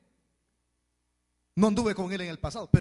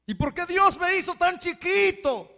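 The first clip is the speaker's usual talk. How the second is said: high, loud whiny question; mock complaining; 11 semitones higher, 8 dB louder than usual. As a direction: whine at God like a sulky child. Mocking a complainer to God.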